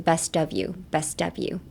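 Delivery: "best of you" run together like "bestuv you"; "best of" as one word in "best of you"; linked words. Shown as a demonstration. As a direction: link "best of you" smoothly. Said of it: "つなげます".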